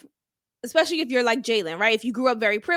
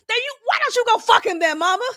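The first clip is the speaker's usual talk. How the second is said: higher, fast, cheeky comeback; sassy retort; 9 semitones higher, 3 dB louder, faster than usual. Retold or imitated. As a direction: snap back at mama, sassy and quick. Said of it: "That's my response if I was the mama".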